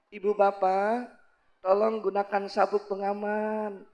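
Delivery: soft, slow cabin announcement; gentle flight attendant. Acted. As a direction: sweetly ask passengers, taking your time. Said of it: "ngomongnya lemah lembut". (pramugari lemah lembut)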